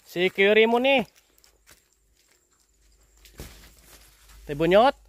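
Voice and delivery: real call to someone out of sight. Real, not acted. Visitor calling an old man from a distance, calling out.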